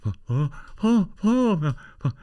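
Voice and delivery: high, halting posh hooting; flustered posh man. Retold or imitated. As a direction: imitate a posh man floundering for words. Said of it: "And he was like".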